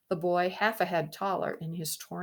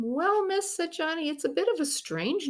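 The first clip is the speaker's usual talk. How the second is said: put-on much higher, hesitant boy; shy boy voice; 11 semitones higher, 4 dB louder than usual. Johnny telling the princess, much higher voice. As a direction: sheepishly explain. Boy shyly explains name.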